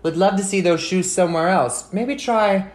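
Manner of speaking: loud, sarcastic teasing about the mess; sarcastic nagging. Acted. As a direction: needle him about the shoes. Sarcastic scolding about shoes.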